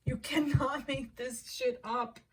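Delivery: slow, high, laughing through the words. Real, not acted. Reader cracking up at the cards, laughing. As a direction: laugh in disbelief as you say it.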